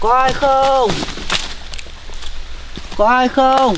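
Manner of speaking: high-pitched call carried into the woods. Acted. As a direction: call out to anyone nearby, stretched. Calling for the baby's parents.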